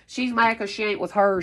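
ordinary talk

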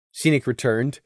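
usual speaking voice

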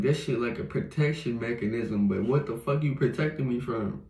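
usual speaking voice

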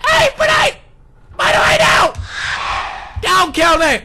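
high, loud frightened shouting; panicked pleading. Real, not acted. Player begging the monster not to kill, panicked shouting. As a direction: cry out for your life.

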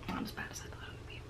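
rushed whispered aside; hurried whisper; fast whisper. Real, not acted. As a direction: toss it off in a quick hushed breath. Quick hushed aside.

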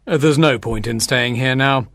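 acted downcast father; sorry father. A narrator's reading. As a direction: say it low, with sad resignation. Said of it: "He felt very sorry for her".

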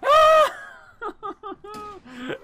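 high loud screaming laugh; panicked laugh-scream. Real, not acted. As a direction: shriek and laugh at once in fright. Screams after jump scare.

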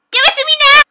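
high, loud angry demand; demanding yell. Acted. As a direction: yell it like a greedy tantrum. Demanding candy after polite request.

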